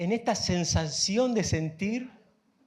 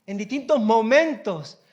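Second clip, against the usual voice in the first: usual speaking voice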